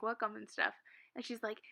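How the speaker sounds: ordinary talk, nothing marked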